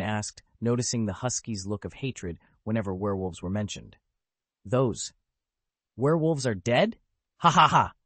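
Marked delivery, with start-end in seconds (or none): 4.6-7.0 sarcastic echo, rising on "dead?"; mocking disbelief. A narrator's reading. Husky mocking Anduin's news, sarcastic rising question. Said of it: "The sarcasm in the husky's words grew sharper". 7.4-7.9 loud mocking "Ha ha ha!"; scornful laugh. A narrator's reading. Husky scoffing at Anduin's claim, loud mocking laugh. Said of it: "The sarcasm in the husky's words grew sharper".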